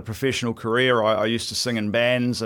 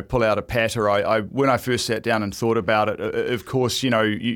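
usual speaking voice

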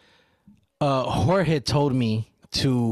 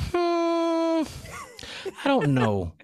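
put-on child voice; much slower than usual. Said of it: "And he's like".

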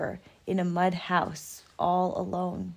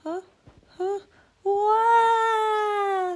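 distant echoing sob; crying wail; 13 semitones higher, 4 dB louder, much slower than usual. Acted. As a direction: wail back like an echo. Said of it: "said the voice from across the pond".

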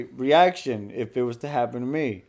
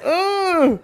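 disgusted ugh; 13 semitones higher than usual. Pretend. Acting out reaction in scenario.

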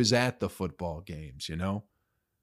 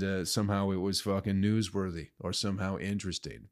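ordinary talk

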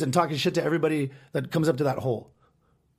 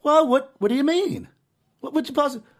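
much higher, whiny puzzled clerk; mock clerk; 11 semitones higher, 4 dB louder than usual. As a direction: play the clueless clerk in a high voice. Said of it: "And he goes".

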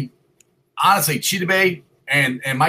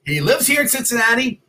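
ordinary talk

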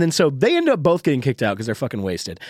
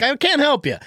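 exasperated refusal; 12 semitones higher than usual. Retold or imitated.

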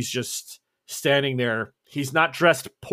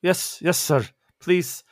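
put-on higher-pitched boy with a foreign accent; eager young shoeshiner's accented voice; 5 semitones higher, slower than usual. Shoeshine boy answering a customer, in accented boyish voice. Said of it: "His Portuguese is good. Not quite native".

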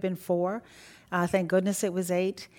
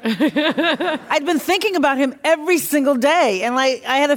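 laughing; 7 semitones higher, 8 dB louder than usual. Real, not acted. Laughing at her own worry.

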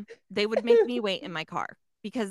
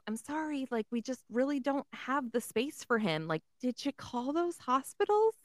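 a lightly raised, softer mock receptionist voice; 4 semitones higher, 6 dB quieter than usual. Vet receptionist brushing off a caller, lightly mimicked higher and softer. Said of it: "she says".

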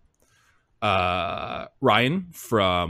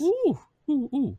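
excited ooh; slower than usual. Real, not acted. Reacting to Frosties.

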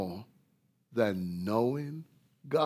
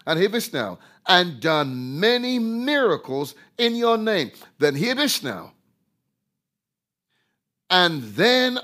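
loud, high preaching climax; high, loud pulpit delivery; fiery preaching; 7 semitones higher, 8 dB louder than usual. Acted. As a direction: thunder the verses at them. Preaching at full force.

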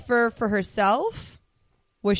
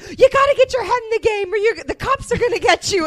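high, urgent mock scolding; imitating Cruella's scolding; 12 semitones higher, 5 dB louder than usual. Cruella warning Jasper and Horace while driving, high mock scolding. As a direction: pitch it way up, as if yelling from a car.

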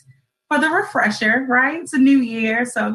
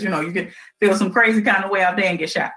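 normal talking voice

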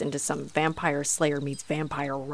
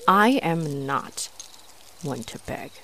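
slow proud insistence; slower than usual. Acted. Proud trapped vampire.

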